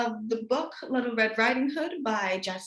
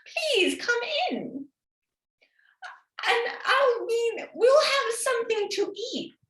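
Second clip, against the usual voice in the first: high, slow falsetto granny put-on; wolf faking a sweet granny voice, high and slow; disguised wolf; 11 semitones higher, slower than usual.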